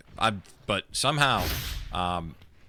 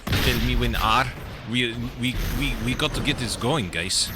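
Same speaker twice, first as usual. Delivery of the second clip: hammy put-on French accent; mock French accent, played up badly. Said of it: "my, uh, incredibly bad French accent".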